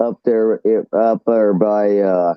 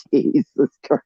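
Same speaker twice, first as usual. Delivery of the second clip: laughs then mutters in amusement; real laughter; laughing off the memory; 3 semitones higher, 4 dB quieter than usual. Real, not acted. Laughing after story.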